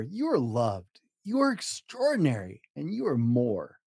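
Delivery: ordinary delivery, nothing marked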